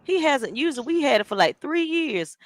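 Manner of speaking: high mock Kiki voice. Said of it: "she was like".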